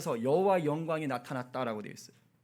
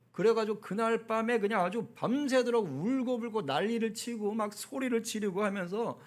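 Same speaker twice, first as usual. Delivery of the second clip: ordinary delivery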